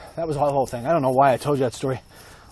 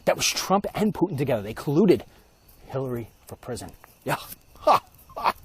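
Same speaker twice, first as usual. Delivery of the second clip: ordinary talk, nothing marked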